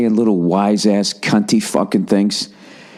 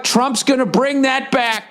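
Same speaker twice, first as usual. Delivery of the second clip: mock grand pronouncement; slower than usual. Mock pronouncement about Trump.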